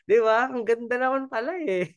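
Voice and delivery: delighted praise. Real, not acted. Praising a mentor's smile.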